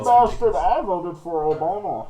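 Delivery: Bane. Acted. Clowning for the co-hosts, Bane impression. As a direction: put on a villain's masked voice. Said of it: "we're doing Bane voices at one point".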